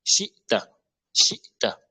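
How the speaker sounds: Shita with a silent catch between shi and ta; stopped hamza. Shown as a demonstration. Teacher repeating the drill, hamza cut off. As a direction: stop short on the hamza in Shita, then release ta. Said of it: "arrêt du son sur le hamza".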